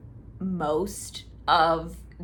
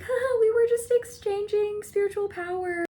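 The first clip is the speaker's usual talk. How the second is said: much higher, flustered and giggly; embarrassed Ji Lian; 12 semitones higher than usual. Retold or imitated. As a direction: laugh it off awkwardly. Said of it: "Ji Lian is all embarrassed".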